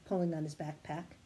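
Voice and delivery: usual speaking voice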